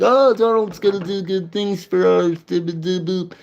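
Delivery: high, airheaded mocking voice; sing-song dopey imitation of a fan; mocking Trump supporter. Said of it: "They're like".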